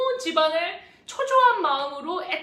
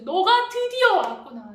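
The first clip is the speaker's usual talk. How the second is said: joyful father; 6 semitones higher, 5 dB louder than usual. Acted. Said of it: "그렇게 말했어요".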